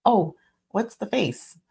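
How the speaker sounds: light, breezy, high teasing question; casual teasing. Retold or imitated. To a colleague whose face furrowed, high breezy tease. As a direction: toss it off playfully to a close friend. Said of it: "I could say it exactly like that".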